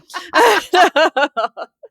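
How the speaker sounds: high breathless cackle; cackling laugh. Real, not acted. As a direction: laugh till it breaks. Laughing at own gremlin.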